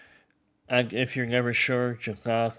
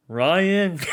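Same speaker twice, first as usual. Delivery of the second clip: higher, louder mock call; hammed-up boss calling a name; imitating the boss; 6 semitones higher, 5 dB louder than usual. Boss calling out to Ryan, mock high call. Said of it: "He'd be like".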